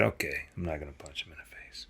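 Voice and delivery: sheepish whisper, barely voiced; whispered concession. Son sheepishly gives in to dad, in a whisper. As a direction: mumble it back like a scolded kid.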